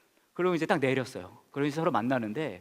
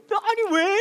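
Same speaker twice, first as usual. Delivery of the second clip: high, loud crying wail; wife crying on the phone over the missed flight; imitated sobbing wife; 8 dB louder, slower than usual. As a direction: wail like a heartbroken girlfriend. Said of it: "제 아내가 막 울기 시작하는 거예요".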